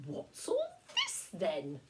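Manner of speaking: slow, kindly puzzled man; lightly voiced neighbour. Mister McNally arrives at the flood, slow and puzzled. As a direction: ask it slowly, like a friendly old neighbour.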